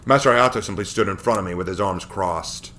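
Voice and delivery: ordinary delivery, nothing marked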